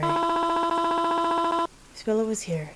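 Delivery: high, slow mourning mother; a frail mother lingering on every word; high drawn-out grieving mom. Acted. Mother character speaks.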